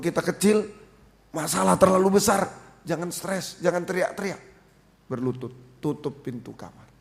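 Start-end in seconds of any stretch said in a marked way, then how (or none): none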